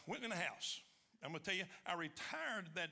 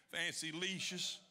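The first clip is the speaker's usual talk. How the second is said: ordinary delivery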